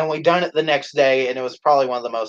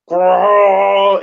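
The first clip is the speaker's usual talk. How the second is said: loud, higher, sustained mock roar; long goofy dinosaur bellow; imitated dinosaur roar; 5 semitones higher, 6 dB louder than usual. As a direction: belt out a pretend T-Rex roar. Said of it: "The next thing you see is Double Swee going".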